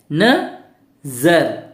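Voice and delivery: नज़र split very slowly into na and zar; sounded out. Shown as a demonstration. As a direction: spell नज़र out by sound, with a long gap. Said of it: "अब हम इसको कैसे पढ़ेंगे?". (नज़र को तोड़कर, न और ज़र अलग बोलें)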